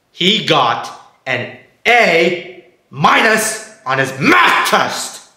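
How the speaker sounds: word by word, drawn out; slow, emphatic repeat. Acted. Mom repeating the crime to dad, slowly emphatic. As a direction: spell it out slowly and deliberately. Can you say that again.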